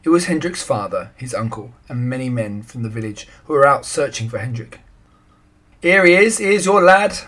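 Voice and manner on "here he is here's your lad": loud, high call across the dike; shouting. A narrator's reading. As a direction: hail the searchers from far off. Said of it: "shouted the man".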